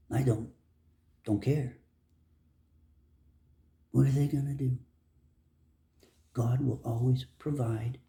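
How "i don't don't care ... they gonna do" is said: normal talking voice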